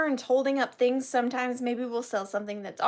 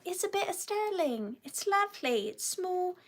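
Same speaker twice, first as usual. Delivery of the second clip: mock British antiques presenter; 6 semitones higher, 4 dB quieter than usual. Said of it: "I'm like".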